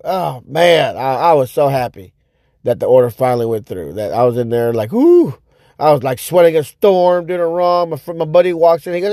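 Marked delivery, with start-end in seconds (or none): 4.9-5.4 high, loud whoop of joy; celebratory whoo. Retold or imitated. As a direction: let out a victory whoop. Celebrating the finished order.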